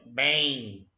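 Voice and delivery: "Bem" drawn out, nasal E gliding to a small I; pronunciation demo. Shown as a demonstration. Teacher showing the hidden diphthong, drawn-out pronunciation demo. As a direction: stretch "Bem" so the final glide shows. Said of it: "aí vem assim, ó".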